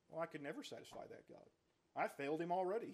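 ordinary talk

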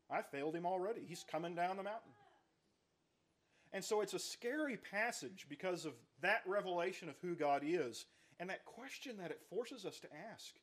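ordinary delivery

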